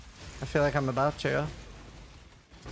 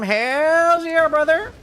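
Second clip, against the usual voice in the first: loud, much higher hammed-up Texan drawl on "Hell yeah, brother."; Texan accent; 10 semitones higher, 8 dB louder, much slower than usual. Streamer declaring to chat in a mock Texan accent. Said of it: "I was Texan this whole time".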